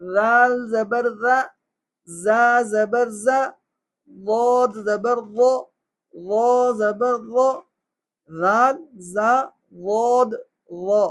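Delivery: slow lisped "رال", buzzed "زا", heavy rounded "واد"; careful letter drill. Shown as a demonstration. Teacher asking for focused practice, slow distinct "رال", "زا", "وو". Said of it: "خصوصی توجہ کے ساتھ آپ مشق کریں".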